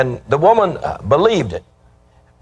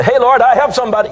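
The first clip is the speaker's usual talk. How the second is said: cheery boast; 9 semitones higher, 3 dB louder, faster than usual. Pretend. Congregant voiced, boasting to God.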